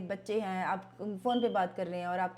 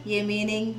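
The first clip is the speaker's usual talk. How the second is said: mocking rote style; 6 dB louder than usual. Shown as a demonstration. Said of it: "हम उसको ऐसे नहीं करेंगे".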